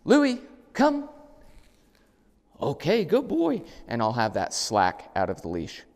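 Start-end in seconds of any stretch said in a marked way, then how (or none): 0.0-1.1 much higher and louder, crisp; recall command. Shown as a demonstration. Trainer calls the dog on the long lead, loud and clear. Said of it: "prepare for that". 2.6-3.6 put-on much higher, slow, sing-song good boy; excited praise. Shown as a demonstration. Trainer praises the returning dog, high and sing-song. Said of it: "prepare for that".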